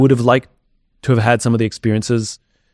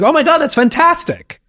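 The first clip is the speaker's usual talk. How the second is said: much higher, thrilled gush; gushing delight. Retold or imitated. Telling someone how to ask for a reaction, squealing with delight. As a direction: gush like you're overjoyed at the offer. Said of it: "I'm like".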